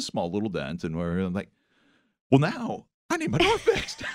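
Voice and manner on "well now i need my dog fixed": high, squeaky mock complaint; mock demanding neighbor. Husband mocking himself demanding his car be fixed, squeaky mock outrage. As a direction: play the indignant owner for laughs.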